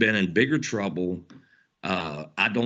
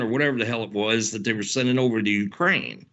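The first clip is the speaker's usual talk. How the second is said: ordinary talk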